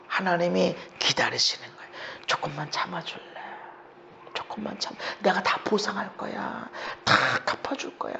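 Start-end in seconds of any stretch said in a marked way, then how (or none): 2.2-8.1 very soft, breath-light promise; hushed voice of a patient God; gentle coaxing. Acted. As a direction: coax tenderly, almost in a whisper.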